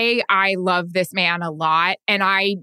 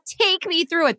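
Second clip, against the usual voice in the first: higher, scornful reporter line; mocking own phrasing; 9 semitones higher than usual. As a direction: sneer at your own words. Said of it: "That's not even a fucking question".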